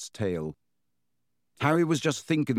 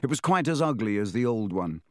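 ordinary delivery

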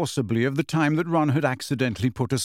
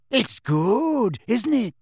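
high, happy, dreamy voice; delighted and far away; happy dreamy Luna; 9 semitones higher, much slower than usual. A narrator's reading. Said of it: "said Luna happily".

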